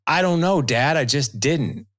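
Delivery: all-caps reply. Retold or imitated. Teen son firing an all-caps text back at his dad, mock yell. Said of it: "and in all caps".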